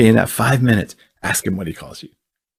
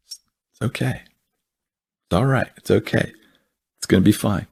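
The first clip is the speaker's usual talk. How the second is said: soft, calm comforting voice, voicing Jesus; gentle reassurance; 5 dB quieter than usual. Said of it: "That's how he talks all the time".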